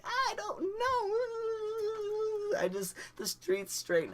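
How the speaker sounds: very high, slow, whimpering whine; whiny crying. Retold or imitated. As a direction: whimper it in stoned confusion. Confused high driving.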